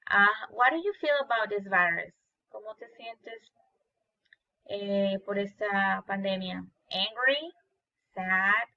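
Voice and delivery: ordinary delivery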